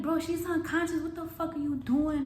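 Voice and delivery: high, urgent retold protest; alarmed confrontation. Retold argument with the man.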